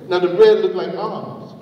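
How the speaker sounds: ordinary delivery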